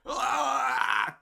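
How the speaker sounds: sudden high pained scream; short agonized death cry. Shown as a demonstration. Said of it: "I gotta do death sounds".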